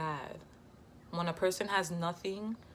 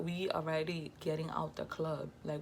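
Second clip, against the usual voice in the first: ordinary talk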